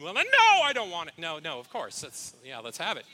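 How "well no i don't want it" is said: high, loud outburst of no; mock-annoyed no, jumping up high and loud; mock indignant refusal. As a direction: play the offended refusal for laughs. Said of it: "and you go".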